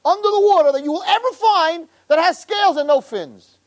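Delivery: high, grand mock proclamation; lightly mocking proclamation. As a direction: declare it like a sweeping decree. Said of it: "make a statement".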